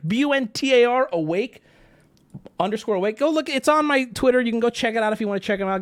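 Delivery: ordinary talk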